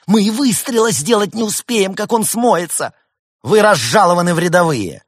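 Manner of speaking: high, loud furious reprimand; angry shouting. A narrator's reading. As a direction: bawl out a subordinate at full force. Commander berates and demotes subordinate.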